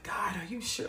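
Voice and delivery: hushed, whispered plea to God; acted whispered double-check; doubtful whisper. Retells doubtful private talk with God.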